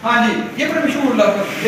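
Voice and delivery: normal talking voice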